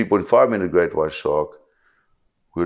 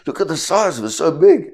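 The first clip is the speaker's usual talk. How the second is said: much higher, gushing with awe at the size; mock tourist amazement, pitched way up; amazed tourists; 10 semitones higher than usual. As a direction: gasp like a first-timer seeing a shark. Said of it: "everybody's like".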